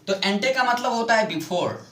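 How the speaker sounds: ordinary talk, nothing marked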